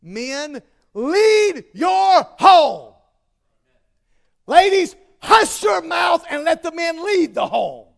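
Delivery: slow, loud commanding shout; emphatic shout. Real, not acted. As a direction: bellow it at the men, drawn out. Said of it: "Let me just say this very emphatically".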